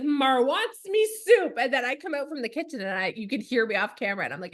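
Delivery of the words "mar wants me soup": put-on 1 pouty toddler. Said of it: "she's like".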